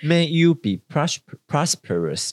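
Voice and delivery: May you be prosperous said slowly, one word at a time; slow careful demo. Shown as a demonstration. 主持人向听众慢慢示范英文祝福. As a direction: read the wish out slowly for learners. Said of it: "就是希望你有个".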